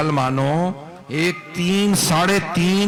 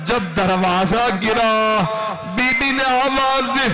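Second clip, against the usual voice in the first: high, shouted mourning recitation; final lament cry; 9 semitones higher than usual. Acted. Preacher voicing the mother's cry at the falling door, shouted lament. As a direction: build up to a wailing cry of the name.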